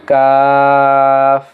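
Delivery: কাফ held long and level, without a push; natural, unforced recitation. Shown as a demonstration. Teacher showing it is said without a push, কাফ drawn out smoothly. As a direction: stretch কাফ smoothly on one even note. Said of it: "এটা স্বাভাবিকভাবে উচ্চারণ হবে".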